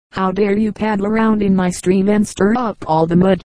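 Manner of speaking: ordinary delivery, nothing marked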